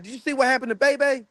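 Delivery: high, excited retelling of a friend; quoting a friend. Retold or imitated. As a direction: pitch up to play the guy asking. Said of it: "he was like".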